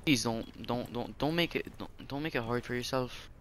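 quiet pleading to an enemy player. Real, not acted. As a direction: beg him softly not to.

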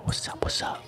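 ordinary delivery

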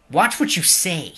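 loud, sharp rebuke; angry rebuke. Acted. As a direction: scold the other sharply. Game character scolds.

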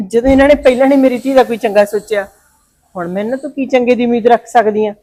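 a mother's furious grievance against her brother; bitter anger. Acted. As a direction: let the old hurt flare up loud.

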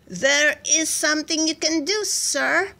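high-pitched, deferential voice; helpful underling. A narrator's reading. One of the men advising the general, deferential. Soldier timidly suggests idea.

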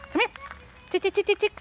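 high, loud chicken call. Real, not acted. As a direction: call the hens in sing-song. Calling chickens to come.

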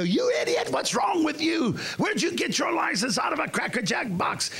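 hollering at a driver through the car window; road rage yell. Retold or imitated. Retelling yelling at a driver who cut him off, high mock rage.

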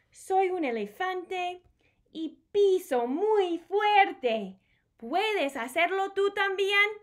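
high, loud and slow stomping read; loud playful elephant read; big elephant voice. Acted. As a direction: boom it out like a heavy elephant.

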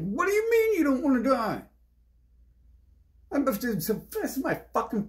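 high, loud disbelieving question; incredulous retort. Retold or imitated. Asking back a person who fears dying, incredulous. Recounts incredulous reply to someone.

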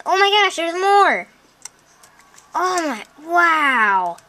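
high, astonished outburst; excited discovery. Real, not acted. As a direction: exclaim it high in delight.

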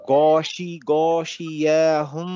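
গশিয়া হুম with the মিম hummed through the nose for two counts; held nasal ghunna. Shown as a demonstration. Quran teacher demonstrating ghunna to his online class, slow and nasal. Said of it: "নাকের মধ্যে একটা ঘং ঘং আওয়াজ".

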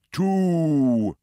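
loud, assured owl voice; owl's answer. Acted. Owl answering the secretary bird, loud and sure. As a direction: state it like an oracle.

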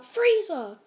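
high, loud cry falling off at the end; retold yell. Deku warns his class of Frieza, yelling out. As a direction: yell the name out as a sudden alarm. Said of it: "he just yells out".

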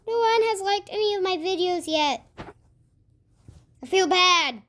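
ordinary talk

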